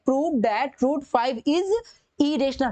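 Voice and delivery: each word drawn out slowly as he writes; slow dictation. Shown as a demonstration. Teacher reading the question aloud to students, slow dictation.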